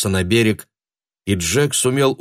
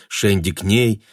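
usual speaking voice

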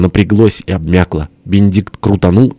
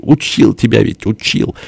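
slightly raised, exasperated scolding; vexed reproach; 4 semitones higher than usual. A narrator's reading. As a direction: scold with annoyance. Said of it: "крикнул тесть с досадой". (с досадой, укоризненно)

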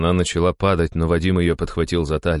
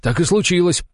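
high, insistent raised voice; barked reproach; 10 semitones higher, 4 dB louder than usual. A narrator's reading. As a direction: throw his own words back at him. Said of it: "рявкнул на него другой дружинник". (резко упрекнуть)

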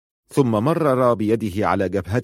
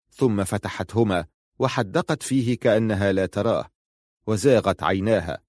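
plain reading voice

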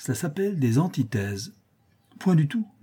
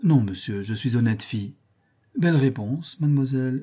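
ordinary delivery, nothing marked

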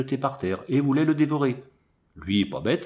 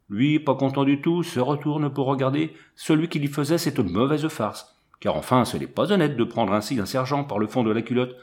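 ordinary delivery, nothing marked

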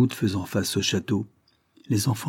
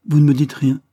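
higher and louder, pressing; old flame urging the colonel to remember; insistent plea; 6 semitones higher, 7 dB louder than usual. A narrator's reading. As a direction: demand to be remembered.

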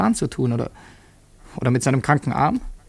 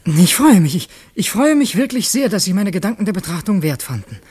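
eager joy; 4 semitones higher, 6 dB louder than usual. Acted. Moved thanks to governor.

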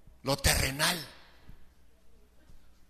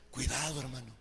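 hushed warning; 6 semitones lower, 6 dB quieter than usual. Real, not acted. Warning softly after the shouting.

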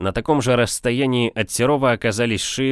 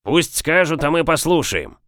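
high-pitched call from the crowd; raised rallying call; crowd's agreeing shout; 7 semitones higher than usual. A narrator's reading. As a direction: take up the cry in agreement. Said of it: "Согласным воплем отозвались".

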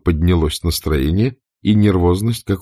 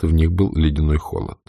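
ordinary delivery, nothing marked

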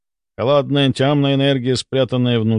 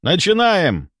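high-pitched start signal; commanding; 8 semitones higher, slower than usual. A narrator's reading. Doctor launching the group ritual, commanding. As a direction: call the team into action.

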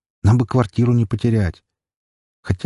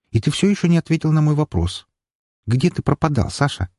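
higher-pitched sister voice, insistent; questioning sister; 6 semitones higher than usual. Acted. Sister questions her brother about his absence, insistent and higher-pitched.

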